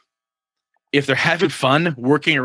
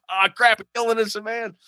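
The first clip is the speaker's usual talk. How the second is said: lightly raised, mock dismay; dismayed players; 11 semitones higher, faster than usual. Players realize they shot an innocent man, mock dismay. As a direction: groan it out as the guilty players. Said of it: "You know".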